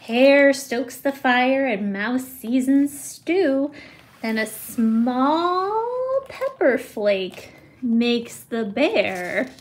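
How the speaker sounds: plain reading voice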